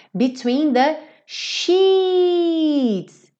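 sheets with a long, drawn-out ee, very slow. Shown as a demonstration. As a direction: stretch the ee of sheets like in cheese. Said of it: "Um som longo". (sheets com o i bem longo e arrastado)

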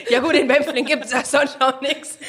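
laughing. Real, not acted. Host teasing the guest about Bempflingen, laughing. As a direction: laugh while poking fun at the village.

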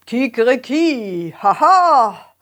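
rooster crowing. Acted. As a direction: crow like a rooster. Rooster crows.

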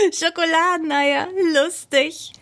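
high laugh, then chuckled words; high-pitched, tickled delight; amused laughter. A narrator's reading. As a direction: keep laughing at the silly idea. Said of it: "Michi schmunzelte".

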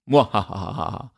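tiefe, böse Lache nach dem Witz; verrückter Wissenschaftler. Pretend. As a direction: lach wie ein Bösewicht im Film. (gespielte Bösewicht-Lache)

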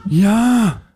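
a lightly voiced husband who flatly refuses; stubborn husband. Husband refusing his wife's walk, lightly voiced. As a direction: play the husband who only wants to sit. Acted dialogue sketch, husband role.

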